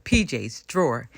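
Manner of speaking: ordinary delivery, nothing marked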